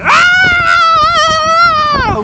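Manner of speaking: long, loud, very high scream. Retold or imitated. Acting out the athlete's third scream, long and piercing. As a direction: hold a piercing mock scream at full volume. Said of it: "Screams again".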